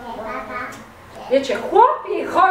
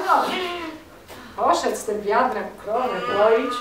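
usual speaking voice

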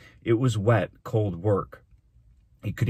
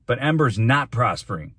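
loud, raised protest; impassioned cry; 5 semitones higher, 6 dB louder than usual. A narrator's reading. Boy contradicting the mayor in class, impassioned cry. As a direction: cry out in objection. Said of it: "he cried".

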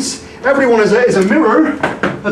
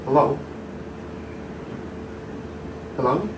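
lost and quiet; 8 semitones lower, 11 dB quieter, much faster than usual. Acted. Alone, calling into emptiness.

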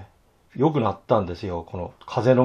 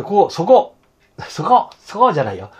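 much higher, louder call of そこ; sudden call to catch the viewers' eye; 9 semitones higher, 8 dB louder than usual. Real, not acted. Pointing out spot to viewers. (視聴者に向けて声を張って呼びかける)

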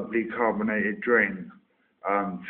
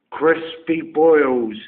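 slow, loud, hammed-up shout; loud silly sign-off; 4 semitones higher, 8 dB louder, much slower than usual. Shown as a demonstration. Ending the video with three random words, shouted slow and loud. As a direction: bellow the last words like a punchline. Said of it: "saying any three words you want".

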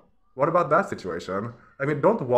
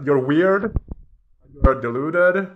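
scolding outburst; 4 semitones higher, 8 dB louder, slower than usual. Real, not acted. Scolding the politician.

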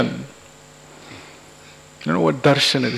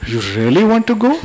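quick, high, rising mock surprise; pretended concern; 6 semitones higher, faster than usual. Husband secretly happy as wife leaves, pretending concern. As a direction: feign dismay with a high rising question. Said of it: "So he pretends and says".

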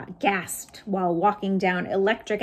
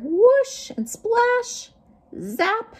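sound effects; 13 semitones higher, 5 dB louder, much slower than usual. Acted. Robot falls into puddle.

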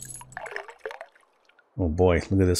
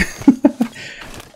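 sheepish laugh. Real, not acted. Laughs at own mispronunciation.

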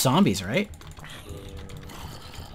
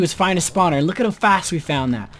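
ordinary delivery

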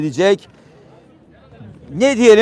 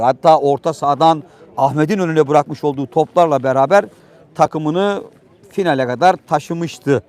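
ordinary talk, nothing marked

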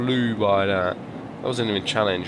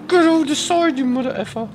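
very high, loud yell; put-on yell; 9 dB louder than usual. Gamer forcing the car over the side, put-on yell.

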